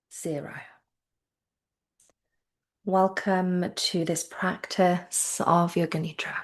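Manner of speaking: slow meditation voice with long, unhurried pauses; spacious, measured yoga nidra guiding; guided meditation. Acted. Leads a yoga nidra countdown.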